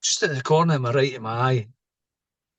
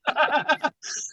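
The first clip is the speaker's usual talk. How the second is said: high, real burst of laughter; hearty laugh. Real, not acted. Laughs after punchline.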